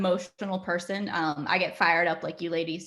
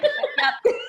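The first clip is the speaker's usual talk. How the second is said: mock-tearful squeak. Fiancée answering fiancé, high mock-tearful squeak. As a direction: squeak it through laughter. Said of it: "I was crying".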